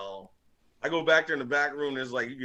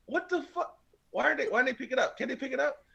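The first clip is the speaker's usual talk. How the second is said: disbelief; 11 semitones higher than usual. Retold or imitated. Calling Harmon in disbelief.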